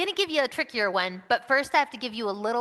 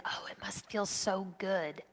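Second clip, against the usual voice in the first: whispered thought; 3 semitones lower, 9 dB quieter than usual. Retold or imitated. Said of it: "the thing that I think is".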